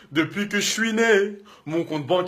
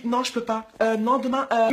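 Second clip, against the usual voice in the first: much softer lazy excuse; mock girl excuse; 6 dB quieter than usual. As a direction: mumble the excuse like a bored girl. Girl's excuse, voiced softly.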